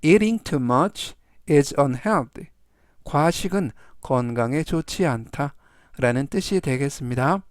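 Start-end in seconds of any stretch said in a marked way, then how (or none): 0.0-2.5 very slow, spaced model sentence. Shown as a demonstration. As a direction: read it very slowly for learners. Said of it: "전체적 의미는".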